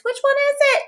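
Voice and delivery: put-on high whiny child asking; whiny kid. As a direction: mimic a pestering kid. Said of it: "just say to your parents".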